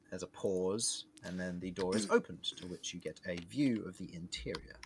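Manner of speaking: ordinary talk, nothing marked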